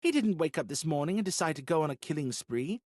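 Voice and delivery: high, worried pleading; anxious. Acted. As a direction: voice rising with worry. Viola anxiously objects.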